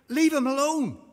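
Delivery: high, loud order; bystanders calling out. A narrator's reading. The rest stop the man with the sponge, high loud call. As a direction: call it out sharply to stop him.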